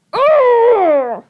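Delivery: loud, high furious roar; villain's roar. Acted. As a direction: roar in frustration. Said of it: "Count Olaf roared".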